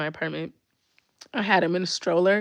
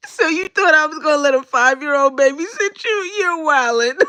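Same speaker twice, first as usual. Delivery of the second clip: incredulous teasing; 7 semitones higher, 6 dB louder than usual. Retold or imitated. Mocking the girl's assumption.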